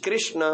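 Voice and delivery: कृष्ण said with kri; pronunciation demo. Shown as a demonstration. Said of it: "बोलो कृष्ण". (कृष्ण का साफ़ उच्चारण)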